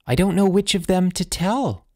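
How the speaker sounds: put-on high-pitched desperate little boy; high, helpless boyish plea; desperate blurting. A narrator's reading. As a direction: blurt it out as a distressed child. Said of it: "Charles blurted out desperately".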